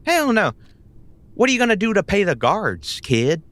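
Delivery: mocking scoff at the kid; scoffing disbelief. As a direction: laugh off a naive kid. Honey Bunny scoffing at player.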